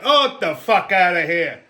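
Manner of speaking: scoffing loudly at the idea; dismissive scoff. Real, not acted. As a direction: brush it off with disgust. Scoffing at the preacher.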